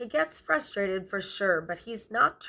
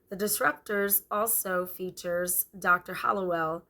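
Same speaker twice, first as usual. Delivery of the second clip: normal talking voice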